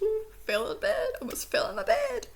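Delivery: high, giddy squeal about nearly falling off the bed; squealing excitement. Real, not acted. Fan telling viewers about the accent scene, squealing. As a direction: gush like a delighted fan.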